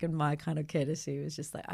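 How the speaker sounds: ordinary talk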